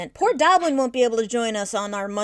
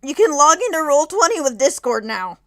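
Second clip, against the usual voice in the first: surprised; 6 semitones higher, 7 dB louder than usual. Real, not acted. Surprised discovery about Discord login.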